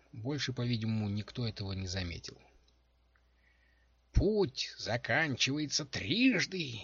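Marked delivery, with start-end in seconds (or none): 4.1-6.8 put-on high, slow, strained old crone voice; old witch muttering. A narrator's reading. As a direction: force out each word like a frail old witch. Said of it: "бормотала бабуля, с трудом выталкивая каждое слово". (бормотание старой ведьмы)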